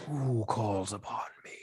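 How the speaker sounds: whispered, ghostly summons; thin distant spirit voice. Acted. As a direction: whisper like a spirit calling from far away. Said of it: "thin echoing voice from far away".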